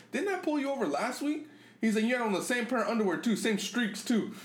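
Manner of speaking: high, mocking voice; mocking cop. Grandpa teasing the gang member he pulled over, high and mocking. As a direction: tease him in a high sing-song. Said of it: "He was like".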